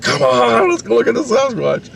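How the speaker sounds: put-on high-pitched childish begging; pleading kid. Kid begging mom to see the Sasquatch, pleading. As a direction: imitate an eager kid begging, voice way up.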